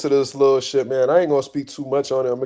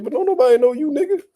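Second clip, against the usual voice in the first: higher, rising mocking taunt; lightly voiced high taunt; 12 semitones higher, faster than usual. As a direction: imitate the rival's teasing jab. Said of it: "He's like".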